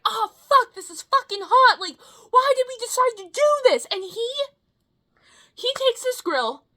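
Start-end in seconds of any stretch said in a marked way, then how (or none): 0.0-3.9 high, loud complaining about the heat; mock pained yelp. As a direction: yell like your hands are burning. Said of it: "Daniel in the background being like".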